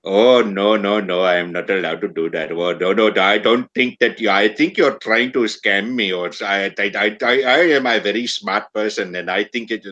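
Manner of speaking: lightly put-on Indian accent, protesting; a hint of an Indian accent, refusing; mock Indian accent. Mocking a local refusing advice, in a mild Indian accent. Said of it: "you get this accent".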